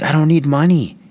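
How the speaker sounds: high-pitched imitated plea of a distressed man; pleading. Homeless man turns down a dollar, pleading. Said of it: "he was crying and pleading for help".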